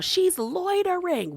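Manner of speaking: high, slow mock yell. Retelling Lisa yelling at Bronwyn, a high slow mock yell. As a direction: shriek it out slowly, imitating. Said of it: "Then Lisa yells out".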